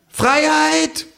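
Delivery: very high, drawn-out kangaroo call; proclaiming kangaroo. Acted. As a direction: call it out from the bar top. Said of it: "ruft das Känguru".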